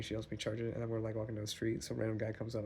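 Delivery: normal talking voice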